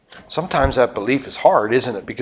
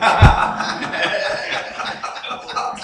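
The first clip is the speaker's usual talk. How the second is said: breathy laugh; 6 semitones higher, 5 dB quieter than usual. Real, not acted. Preacher laughs at own joke.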